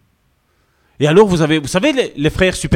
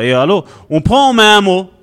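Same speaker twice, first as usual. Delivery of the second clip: normal talking voice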